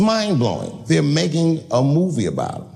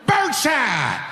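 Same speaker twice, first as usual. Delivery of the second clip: high, mock-heroic battle cry; triumphant threat; 12 semitones higher, slower than usual. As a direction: relish pulling the trigger. Firing at intruder.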